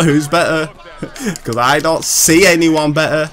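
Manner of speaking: normal talking voice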